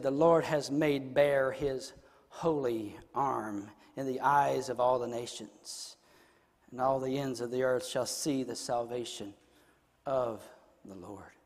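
low, soft reading with weighty pauses; solemn scripture reading. Real, not acted. Preacher reading Isaiah to the congregation, low and reverent.

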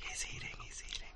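hushed whisper, quick. Real, not acted. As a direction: whisper like a golf commentator. Whispering commentary while he eats.

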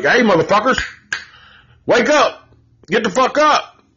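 put-on high, sharp yell at the joking kids; snapping. Sean snapping at his joking friends, high angry yell. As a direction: snap at them to get serious. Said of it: "Sean snaps".

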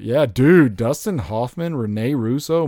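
loud, eager yeah dude; excited. Real, not acted. Reading the cast list to his friend, excited. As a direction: pump it up with real excitement.

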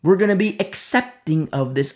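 hammed-up lax pastor excusing sin; mocking pastor. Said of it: "I wonder what the pastors were like".

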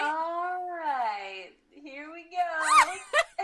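slow, loud laugh-crying. Real, not acted. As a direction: break up laughing mid-line. Attempting the okay-bye.

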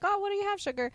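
imitated waitress, pitched well up; high, sugary order-taking voice; flirty waitress. Waitress taking Charlene's order with a hand on her shoulder, flirty. Acts waitress greeting a customer.

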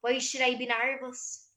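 slow demo of an Irish accent; audition-style Irish accent. Shown as a demonstration. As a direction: ask it with an Irish lilt. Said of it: "That's Irish".

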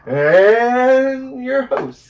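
slow, high, loud talk-show intro; Johnny Carson. Retold or imitated. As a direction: stretch it out like a late-night host. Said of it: "a Johnny Carson".

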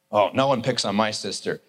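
low trucker voice; trucker-voiced boy. Big brother defending his sister, low trucker voice. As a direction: growl it low like a protective trucker. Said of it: "he sounded like a trucker".

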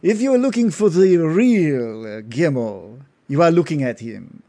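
a proud noble revealing himself with a flourish; Gindar's voice. Acted. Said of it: "lets out a hearty laugh".

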